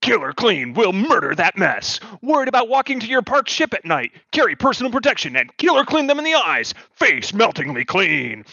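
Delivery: high, punchy infomercial pitch; ad announcer. Acted. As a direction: sell it like a cheesy ad. Spoof commercial read.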